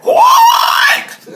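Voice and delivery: very high, loud pig squeal; shrieked oink. Acted. Herman squeals at the wolf at his door.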